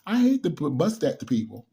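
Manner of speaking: usual speaking voice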